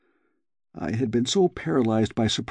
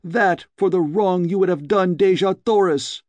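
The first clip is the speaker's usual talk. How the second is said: vengeful cry; 8 semitones higher than usual. A narrator's reading. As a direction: hurl it at him with the blade. Stabbing him, crying vengeance.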